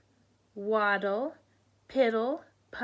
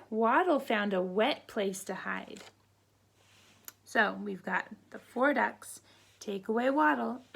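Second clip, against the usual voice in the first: ordinary delivery, nothing marked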